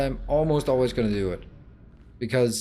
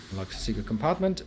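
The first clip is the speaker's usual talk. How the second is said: ordinary delivery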